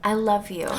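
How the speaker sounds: ordinary talk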